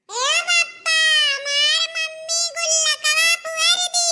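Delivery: put-on high, slow little boy telling tales; child complaining to his father about mother; tattling child. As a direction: whine it to papa.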